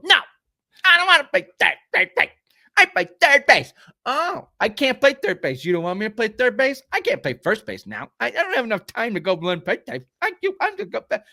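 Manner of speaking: put-on high, fast whiny toddler voice; toddler speak. Host mimicking the ballplayer as a whining two-year-old. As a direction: whine it like a sulky two-year-old who won't switch positions. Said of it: "I was just in toddler speak".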